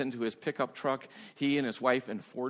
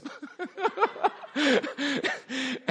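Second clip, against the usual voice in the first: high laughter; 12 semitones higher, 4 dB louder than usual. Real, not acted. Preacher laughing at the headstand story. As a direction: burst of high real laughter.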